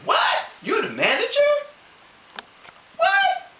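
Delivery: high, loud shocked disbelief. Retold or imitated. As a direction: squeal out the shock that someone took his job. Shocked at the new manager.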